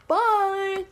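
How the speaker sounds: loud, high, stretched-out farewell; sing-song cartoon sign-off; cheery goodbye. He walks away with a smile, loud cheery goodbye.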